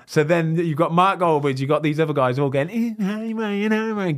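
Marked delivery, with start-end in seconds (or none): 2.7-4.2 higher, hammed-up fan greeting; a cocky friend's show-off hello, lightly mocked. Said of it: "giving it all the big and like that".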